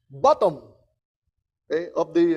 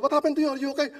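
worried boss; 7 semitones higher, faster than usual. Retold or imitated.

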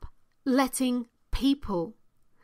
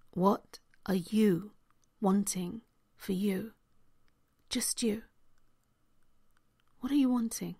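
whispering; 3 semitones lower, 7 dB quieter than usual. Real, not acted. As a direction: breathe the question close. Intimate question again.

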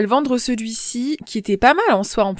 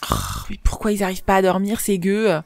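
ordinary delivery

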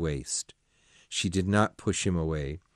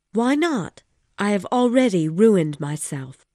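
agitated woman, raised and loud; Missus de Renal, loud and pitched high; 10 semitones higher, 6 dB louder than usual. Acted. Woman reproaching her lover, loud and upset. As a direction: pour it out, upset. Woman character voiced in story.

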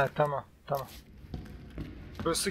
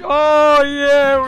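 higher, louder relieved cheer; triumphant cheer; 6 semitones higher, 5 dB louder than usual. Real, not acted. Player escaping just in time, triumphant cheer. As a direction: celebrate the narrow escape.

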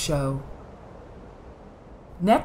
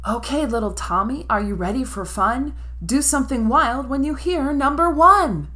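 put-on higher, teasing child voice; playful sister; 7 semitones higher, 3 dB louder than usual. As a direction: build up the surprise. Ginger teases Tommy.

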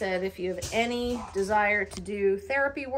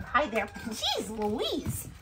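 ordinary delivery

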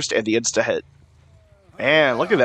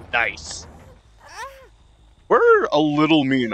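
ordinary talk, nothing marked

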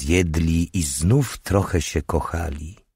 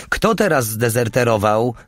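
higher-pitched call from another room; mild shouted question, pitched up; 6 semitones higher than usual. A narrator's reading. Alicja calls from the kitchen, calling out. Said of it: "zawołała z kuchni Alicja".

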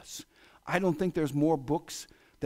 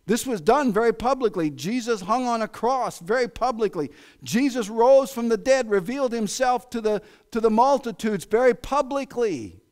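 higher, louder, driving pulpit voice; preaching at full force; 7 semitones higher, 6 dB louder than usual. Acted. Preacher drives home his point, loud and high. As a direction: build it like a revival preacher.

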